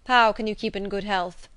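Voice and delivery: loud, preacherly admonishment; stern solemn gentleman, reproving. A narrator's reading. Gentleman warning the girl of early death, stern. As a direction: lecture a child with grave, forceful authority. Voices stern clergyman lecturing child.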